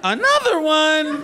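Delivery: high, loud, slow mock disbelief; shocked girlfriend. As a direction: drag out the shock at another. Girlfriend shocked, [name] joke.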